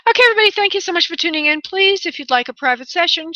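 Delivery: high-pitched, chirpy host's thanks; upbeat sign-off. Real, not acted. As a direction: address all the listeners brightly, voice lifted. Host turns to audience, signing off.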